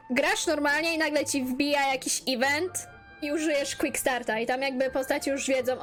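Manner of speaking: ordinary delivery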